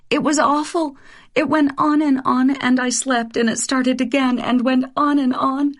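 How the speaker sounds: high, upset and breathless; high frightened retelling; shaken young wife. Acted. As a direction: pour out the nightmare. Wife recounts nightmare.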